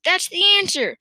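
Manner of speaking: high-pitched, elated declaration; triumphant cry. A narrator's reading. Farmer certain he has the recipe, crying out elatedly. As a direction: announce it as the solution. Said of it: "cried Mister Cranky".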